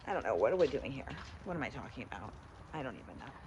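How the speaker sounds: low, quiet self-conscious mumble; embarrassed mumble. Real, not acted. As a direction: drop your voice, embarrassed in public. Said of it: "I'm getting embarrassed".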